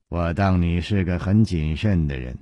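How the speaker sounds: a sneering jab at his caution; mocking. A narrator's reading. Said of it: "楚留香啊". (讥讽地说“很谨慎”)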